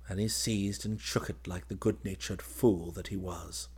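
ordinary delivery, nothing marked

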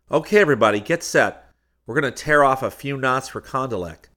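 rally the group before takeoff; calling out. A narrator's reading. Seaton calls everyone to get set.

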